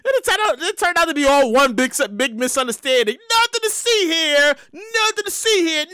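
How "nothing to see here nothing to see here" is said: higher, drawn-out sing-out; mock official dismissal. Official playing down a misunderstanding, mock calling out. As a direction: wave it away in a slow, higher mock announcement.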